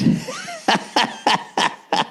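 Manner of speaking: breathy high laugh in short bursts; high wheezing laugh. Real, not acted. Storyteller cracking up at his friend's admission, high wheezing laugh.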